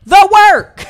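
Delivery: high, loud exasperated snap; exasperated shout. Retold or imitated. Wife as teacher yells the answer at the lazy student. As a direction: yell the obvious answer. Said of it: "You're like".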